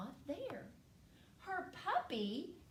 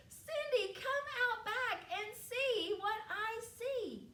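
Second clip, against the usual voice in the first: high, drawn-out holler; excited brother summoning his sister; calling sister outside; 11 semitones higher than usual. Acted. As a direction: call across the yard, slowly and high.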